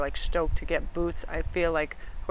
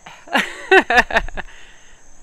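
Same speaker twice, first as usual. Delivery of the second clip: loud real cackle; high, hearty belly laugh; big laugh; 6 semitones higher, 9 dB louder than usual. Real, not acted. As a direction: burst out laughing at your own joke. Laughs at own joke.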